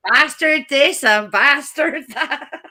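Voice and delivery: slow, rising indignation, swear words punched; ranting Irish housekeeper. Retold or imitated. Housekeeper reporting dirty book to priest, indignant rant. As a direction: hit each BASTARD with relish. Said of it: "his housekeeper telling her".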